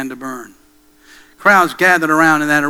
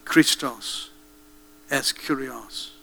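muffled, slow and much softer; a faint last cry from inside the fire; a weak, muffled final confession; dying cry; 2 semitones lower, 8 dB quieter, much slower than usual. Retold or imitated. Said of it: "Polycarp cried".

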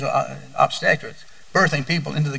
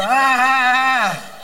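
mock groan; 8 semitones higher, 3 dB louder, much slower than usual. Exasperated grandparent groan.

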